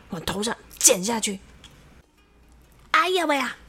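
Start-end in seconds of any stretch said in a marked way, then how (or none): 2.9-3.6 pained cry out. A narrator's reading. Xiao Wang cuts his own scalp, pained cry. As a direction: cry out in mock pain. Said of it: "小王大叫了起来".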